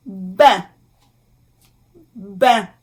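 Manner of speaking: "Bain" in French, nasal vowel, silent n; careful French pronunciation. Shown as a demonstration. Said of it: "So, A I N".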